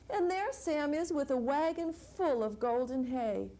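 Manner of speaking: ordinary delivery, nothing marked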